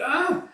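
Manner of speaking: short, high, loud "ah"; sudden gasped yelp; imitated cry of alarm; acted startled cry. Woman cries out dropping child.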